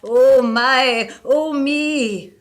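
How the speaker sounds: high, loud ghostly wail from the chimney. A narrator's reading. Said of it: "it cried".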